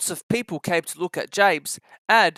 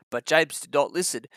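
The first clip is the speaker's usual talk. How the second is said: usual speaking voice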